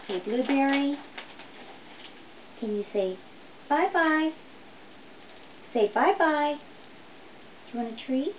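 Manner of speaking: high coaxing pet voice; talking to a parrot. Real, not acted. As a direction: coax the bird, sweet and loud. Owner coaxing parrot to say bye-bye.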